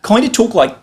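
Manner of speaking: high-pitched ocker Australian voice; ocker Australian accent. Shown as a demonstration. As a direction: ham up a broad ocker Aussie accent. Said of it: "They had that kind of accent".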